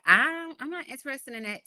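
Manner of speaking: high, loud, brushing it off; dismissive husband. Retold or imitated. Guest mimics executive husband refusing marriage books, dismissive and high. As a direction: wave the idea away like a busy executive. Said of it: "He's like".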